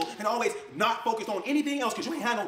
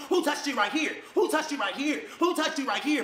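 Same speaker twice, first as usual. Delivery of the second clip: mock interrogating scream; 7 semitones higher than usual. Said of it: "screaming at her".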